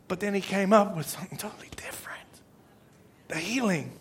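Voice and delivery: hushed unvoiced whisper. Real, not acted. Preacher turning to the surprise of healing, whispered. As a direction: let it drop to a secret whisper. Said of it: "unvoiced whisper".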